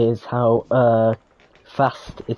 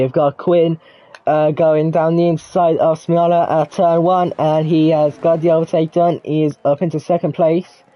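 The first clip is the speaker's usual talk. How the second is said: louder live race call; motorsport commentator; 5 semitones higher, 4 dB louder than usual. Acted. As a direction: call the overtake as it happens.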